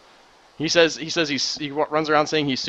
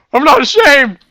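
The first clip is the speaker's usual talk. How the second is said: much higher, much louder cry of pride; defiant shout; 13 semitones higher, 15 dB louder than usual. Real, not acted. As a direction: yell it out proudly. Defiantly owning his high voice.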